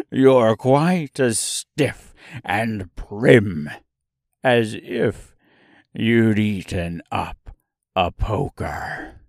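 very slow, saucy clown voice. A narrator's reading. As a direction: tease in a sly clown voice.

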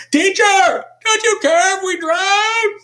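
high, slow desperate shout; panicked cry. Retold or imitated. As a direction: cry out in terror in the storm. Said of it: "it's kind of more like that".

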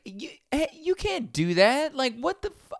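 very high-pitched incredulous protest; mock outrage. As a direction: imitate flustered staff objecting, voice shooting way up. Rental staff's outraged reply, acted.

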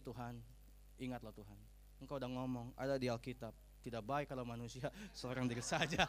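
low, soft, humble prayer; pleading prayer. Acted. Preacher models a single believer praying to God, low and earnest. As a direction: gently remind God of his own promise. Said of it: "Maka kita doanya gini".

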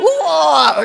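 loud, high open-mouthed roar; mock lion's bellow; imitated lion roar. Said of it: "mengeluarkan auman singa Yehuda".

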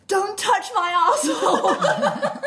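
high, loud, drawn-out mock scream breaking into laughter; retold scream. Storyteller imitating a woman in labour, mock screaming. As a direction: shriek it slowly as an outraged patient, laughing. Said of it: "the woman just starts screaming".

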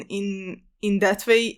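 ordinary talk, nothing marked

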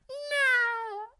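very high tiny cat voice. Acted. Host as a cat, denying it's Mister Cuddles, in a tiny high voice. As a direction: squeak it like a cat. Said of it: "I'm Adolf Hitler, the cat".

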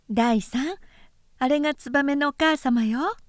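a hint of a playful nurse. A narrator's reading. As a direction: gently humour the little boy. Nurse points out mother swallow.

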